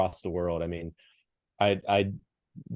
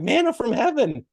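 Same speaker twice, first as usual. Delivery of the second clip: excited; 5 dB louder than usual. Retold or imitated. Boss thrilled at the rock climbing.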